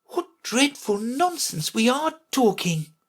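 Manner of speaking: high-voiced private thought; Alice thinking. A narrator's reading. Alice privately judging the conversation, thinking to herself. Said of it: "thinking to herself".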